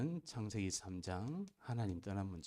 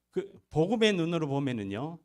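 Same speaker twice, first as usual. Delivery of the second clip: fervent exclamation; 7 semitones higher, 7 dB louder than usual. Real, not acted. Preacher's climactic point.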